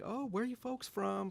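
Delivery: high, chatty cashier impression; high-pitched mock friendliness; super talkative clerk. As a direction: greet customers with eager small talk. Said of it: "He's super talkative".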